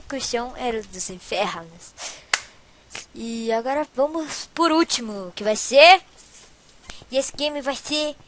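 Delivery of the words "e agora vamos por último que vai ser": usual speaking voice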